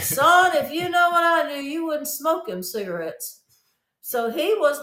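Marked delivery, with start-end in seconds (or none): 0.0-3.4 loud, high preacher voice; preaching. Retold or imitated. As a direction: preach at him like a revival preacher. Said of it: "like, preaching after that".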